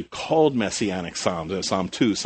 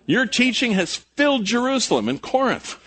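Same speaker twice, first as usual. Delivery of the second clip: high, raised accusing voice; acted criticism; 6 semitones higher than usual. Critics charging the Christians with upsetting the world, high emphatic accusation. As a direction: preach the accusers' charge with rising force. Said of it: "What were the criticisms they said".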